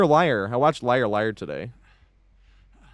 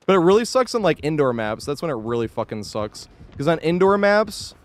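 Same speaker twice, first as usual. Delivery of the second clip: ordinary talk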